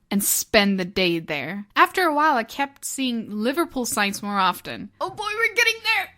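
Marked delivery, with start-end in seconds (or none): 5.0-6.1 very high, fast excited little voice; excited squeal. Acted. Beatles fan nearing Liverpool in the car, excited squeal. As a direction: squeak it out with giddy anticipation.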